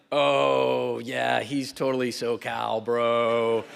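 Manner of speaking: a hint of a chill California bro; mock surfer-bro drawl, half serious; SoCal bro. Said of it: "and you're like".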